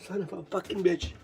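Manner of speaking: ordinary delivery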